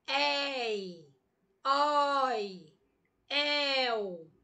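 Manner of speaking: "Ei, oi, el" drawn out with wide-open vowels; open diphthongs. Shown as a demonstration. Teacher showing students open diphthongs, "Ei, oi, el" long and wide open. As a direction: open the mouth wide on each of "Ei, oi, el". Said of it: "esses ditongos abertamente".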